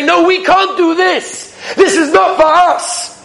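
whiny excuse. Retold or imitated. Mocking our own excuses.

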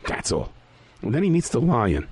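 ordinary delivery

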